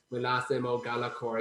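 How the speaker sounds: ordinary talk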